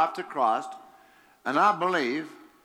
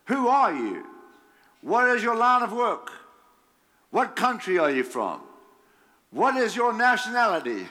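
higher, urgent interrogation; rapid-fire questions; 6 semitones higher than usual. Acted. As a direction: fire each question at him. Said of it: "questions are flying like machine gun bullets".

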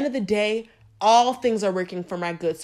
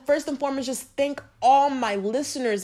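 ordinary talk

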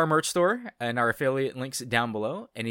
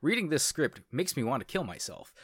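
ordinary delivery

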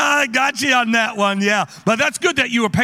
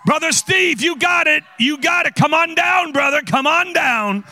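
calling out; 7 semitones higher than usual. Real, not acted. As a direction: summon him down with a big cheer. Calling a man to the front.

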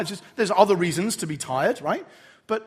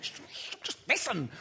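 breathy whisper, much softer; hushed plea; 3 semitones higher, 7 dB quieter, slower than usual. Retold or imitated. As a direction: whisper to a distracted husband to just listen. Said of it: "And Rachel, like".

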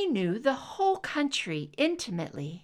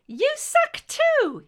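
put-on high, slow, bratty little boy's taunt; taunting child; 8 dB louder, slower than usual. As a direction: tease them like a cheeky five-year-old. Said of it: "sticking out his tongue".

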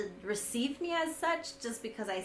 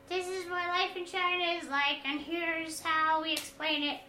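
higher, lightly voiced guide explaining China; mock tour guide; 7 semitones higher than usual. Mocking a typical expat vlogger explaining China. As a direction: play a bland explainer, a little higher. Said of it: "We don't wanna just tell you".

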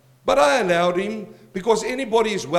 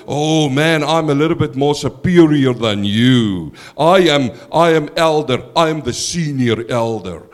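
a lower, louder, pompous put-on voice of a self-important pastor, building on "I am"; preacher mocking a proud church elder, hammed-up superior voice; mock arrogant pastor; 5 semitones lower, 5 dB louder than usual. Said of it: "They like you to walk in and go".

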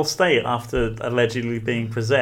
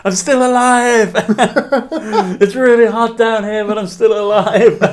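Will Ferrell impression; 7 semitones higher, 5 dB louder than usual. Quoting Austin Powers to a friend, high loud hammed-up cry. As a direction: play the cheerful fallen henchman. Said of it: "and he's like".

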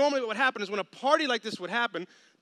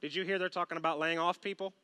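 gloomy gossip; 6 semitones lower, 7 dB quieter, faster than usual. Retold or imitated. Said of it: "that person who's over there going".

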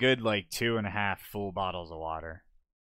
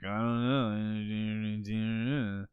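very drawn-out wordless drone; imitated dazed mumble; much slower than usual. Mimicking unresponsive friend, long dazed mumble. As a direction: stretch it into one long, slurred mock groan. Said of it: "You were just like".

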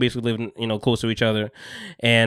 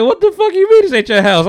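high, loud laughing outrage; mock outrage cracking into laughter; laughing disbelief; 4 dB louder than usual. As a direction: laugh through the frustrated question. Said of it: "I'm like".

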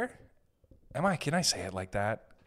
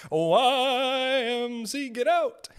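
high, drawn-out, louder shout; mock bouncer shout; 12 semitones higher, 7 dB louder, much slower than usual. YMCA staff shouting seniors out, hammed-up drawn yell. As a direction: throw the old guy out with a drawn yell.

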